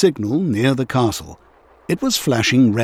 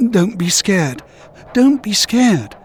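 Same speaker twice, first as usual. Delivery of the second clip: rising higher and slower with nerves; pep himself up, getting more panicked; huffing to himself; 7 semitones higher, slower than usual. A narrator's reading. Thomas steadying himself in the dark, nervous huffing. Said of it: "he huffed to himself".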